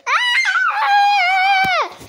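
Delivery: sharp high scream; startled scream. Real, not acted. Kid startled by the camera, loud shriek.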